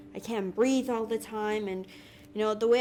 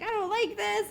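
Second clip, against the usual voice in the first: a much higher, scornful "this is bullshit"; mock angry outburst; 10 semitones higher than usual. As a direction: toss it off high and fed up, a retold rant. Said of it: "I was already angry about that".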